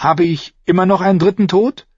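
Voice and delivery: put-on high girl character voice; eager young girl. A narrator's reading. Girl asking the assassin a favour, high and eager. Girl Arya asks Jaqen.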